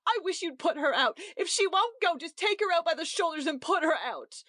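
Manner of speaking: an enraged hostess telling her husband to throw the guest out; livid rage. A narrator's reading. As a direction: demand it of her husband in fury. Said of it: "Missus Markey was now livid with rage".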